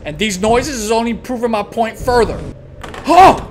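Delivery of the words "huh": sharp loud high gasp; startled gasp. Real, not acted. Player startled by a noise, sharp gasp. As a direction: jolt at the sound.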